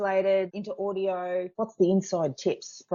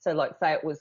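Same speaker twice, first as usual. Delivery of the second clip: ordinary talk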